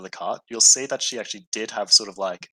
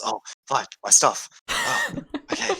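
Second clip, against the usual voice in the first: flustered Samantha; 7 semitones higher than usual. Acted. GM voices distressed Samantha.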